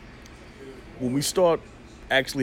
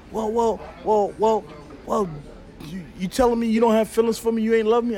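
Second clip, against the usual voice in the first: flustered woman; 6 semitones higher than usual. Retold or imitated.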